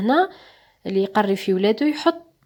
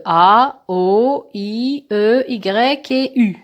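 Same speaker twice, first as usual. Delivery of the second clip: name each vowel slowly and clearly; slow, spelled-out vowels; 3 dB louder, much slower than usual. Shown as a demonstration. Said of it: "je vais entourer les voyelles".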